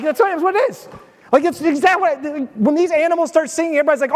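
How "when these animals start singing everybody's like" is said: ordinary talk, nothing marked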